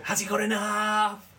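high, drawn-out excited commentator impression; over-the-top commentator's cry, slow and high; Nick Knight. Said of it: "it's just his style is so ridiculous".

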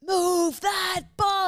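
high, loud catchphrase stretched out slowly; TV host shout. Retold or imitated. Repeating the TV catchphrase, loud drawn-out shout. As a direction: bellow the makeover show's catchphrase. Quoting makeover show catchphrase.

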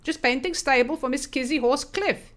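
put-on light Swedish accent. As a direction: say it with a Swedish lilt. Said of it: "in his odd Swedish tang".

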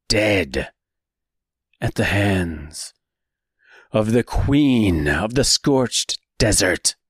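slow, grave storyteller voice; dark story narration. A narrator's reading.

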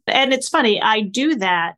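normal talking voice

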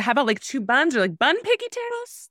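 high, coaxing suggestion; hopeful mom voice. Retold or imitated. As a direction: sweetly pitch an alternative. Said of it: "I said".

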